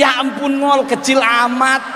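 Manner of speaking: mock him loudly like a laughing friend. A friend teasing him about size, loud mocking. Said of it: "temen gua langsung bilang begini".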